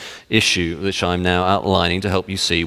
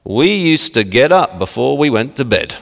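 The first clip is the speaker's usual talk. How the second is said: jokey, raised Yorkshire imitation; Yorkshire accent; 3 semitones higher than usual. Old-timer teasing the young about easy lives, Yorkshire accent. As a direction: boast of hardship in mock Yorkshire. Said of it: "and it's a Yorkshire accent".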